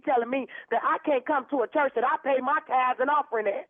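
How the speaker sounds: ordinary delivery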